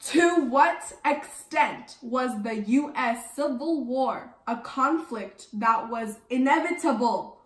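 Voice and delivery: slow, carefully articulated reading; enunciating. Acted. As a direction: sound out each word for the class, slowly. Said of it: "enunciate, please".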